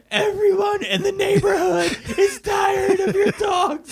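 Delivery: very high, slow, stretched-out holler; imitated long-distance yelling. Will Ferrell shouting complaints from a balloon, strained yelling. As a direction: holler each word so it carries to the ground. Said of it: "starts to yell down to the neighborhood".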